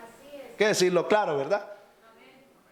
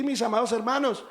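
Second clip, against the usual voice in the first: usual speaking voice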